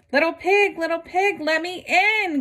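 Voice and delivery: a hungry wolf demanding at the door; growling wolf. Acted. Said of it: "growled the wolf".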